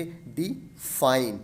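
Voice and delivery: slow डी fine, fine drawn out and stressed. Shown as a demonstration. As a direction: split it in two, light डी, strong fine. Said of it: "fine पे बहुत ज़्यादा stressed दिया जा रहा है". (Fine पर ज़ोर, डी हल्का)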